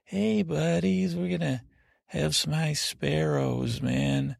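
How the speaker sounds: modern dude voice. Retold or imitated. Podcaster imitating a young bro, high and slow. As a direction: stretch it out like a goofy bro.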